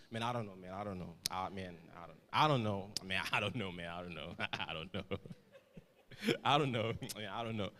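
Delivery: mock a cool slacker's drawl; swaggy slang drawl. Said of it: "some add phonetics to it".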